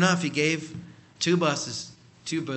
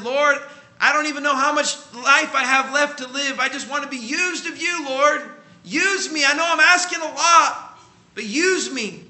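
much higher, louder pleading prayer; impassioned prayer; 10 semitones higher, 9 dB louder than usual. Acted. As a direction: preach it at full force, begging to be used. Dying woman's prayer, preached.

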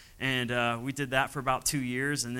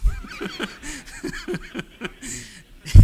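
big laugh; 9 dB louder than usual. Real, not acted. Laughs at his own prayer.

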